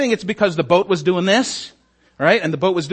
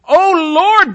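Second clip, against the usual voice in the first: much higher and louder desperate plea to the Lord; pleading cry; 11 semitones higher, 7 dB louder than usual. Acted. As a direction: cry out to God begging. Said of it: "they pleaded".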